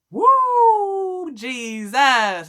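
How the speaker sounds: high, loud exclaim of relief; praising cry of relief; joyful relief. Real, not acted. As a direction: shout it like you just got away. Relief after police let go.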